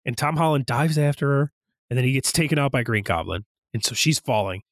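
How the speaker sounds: ordinary talk, nothing marked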